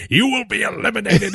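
General Hux ranting. Retold or imitated. Retelling the movie to a friend, high mock villain rant. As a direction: ham up a pompous villain giving a speech. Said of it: "General Hux was going off like".

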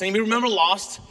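ordinary delivery

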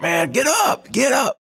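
high yelling get up; retold yell. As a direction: shout at teammates while spraying champagne. Spraying champagne, yelling at teammates.

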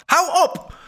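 very high-pitched cry of exasperation; sudden shout. A narrator's reading. Old woman interrupts the haggling buyer, shouting. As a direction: cut him off with a high yell. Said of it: "schreeuwde de oude vrouw".

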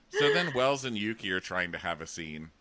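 ordinary delivery

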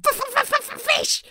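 very high, squeaky creature stutter; high-pitched stuttering little dragon; stammering baby dragon. A narrator's reading. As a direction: stammer it out as a tiny demanding dragon. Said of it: "said Toothless again".

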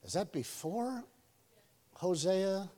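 slow, quiet puzzled voice; confused student. Retold or imitated. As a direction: act clueless and unsure. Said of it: "You're like".

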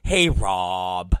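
low, dopey mimicry; mocking employee impression. Unreliable employee greeting his boss, low mocking voice. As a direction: imitate a sheepish caller, voice dropped low.

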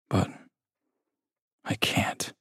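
breathy whispered give-up. A narrator's reading. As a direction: barely voice it, defeated. Despairing narrator, knife in hand.

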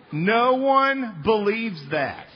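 each word weighed, slow and loud; emphatic, slowed. Real, not acted. Preacher drives his point home to the congregation, slow and loud. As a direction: hammer the point home slowly.

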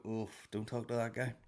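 soft, mock-wary aside; acting out a discreet warning; hushed warning. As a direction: warn a friend off under your breath. Voices people warning each other off him.

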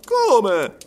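loud, high cry of disbelief; astonished cry. A narrator's reading. Servant shocked by the sum, crying out. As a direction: burst out in astonishment. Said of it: "gridò Passepartout".

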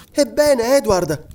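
eager, high-pitched exclamation; exclaiming. A narrator's reading. Wife to her husband just home, exclaiming. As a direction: call out to him in a high, urgent voice. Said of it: "esclamò Elena".

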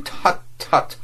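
high, breathless, disapproving tut tut; scornful tut tut, brushing him aside; frowning tut tut. Acted. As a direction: cut him off with an irritated tut tut. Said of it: "broke out Mister Vladimir with a frowning grimace".

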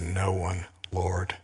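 barely-voiced, soft answer; hushed reply. Woman answering Jesus in a hushed, soft whisper. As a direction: breathe it out in awe.

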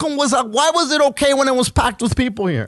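high, worked-up outrage; rant, pitched well up; indignant rant. Real, not acted. Heated rant about mask rule.

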